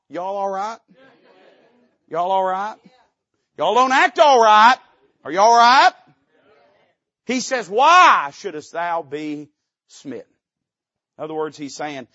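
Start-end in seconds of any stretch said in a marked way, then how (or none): 0.1-5.9 building from easy to loud, high calls of "all right?"; joking calls growing to a shout; mock concern. Mocking congregation's silence. 7.7-10.2 loud, slow menacing question; loud, slow royal warning; king's threat. Retold or imitated.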